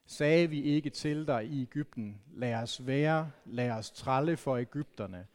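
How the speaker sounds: slow, measured Bible reading to the congregation; solemn scripture reading. Acted. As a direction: read it slowly and gravely, like a lectern reading. Reads scripture text aloud.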